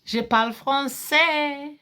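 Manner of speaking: slow, high, drawn-out brag; triumphant boast. Real, not acted. Woman showing her French to those who laughed, triumphant boast. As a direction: crow it, lingering on the last word.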